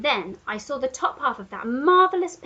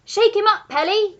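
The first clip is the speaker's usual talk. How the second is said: high, loud cheering giraffe; Giraffe crying out; 11 semitones higher, 9 dB louder than usual. A narrator's reading. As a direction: egg him on gleefully. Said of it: "cried the Giraffe".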